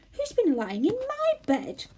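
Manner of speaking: high, slow and indignant; Mother Bear. A narrator's reading. Mother Bear asking about her bed. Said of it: "said Mother Bear".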